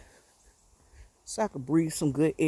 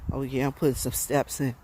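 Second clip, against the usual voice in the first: ordinary delivery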